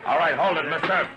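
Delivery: loud order to clear the crowd; commanding shout. Acted. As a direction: bark it out so the whole street hears. Marshal stops the bully.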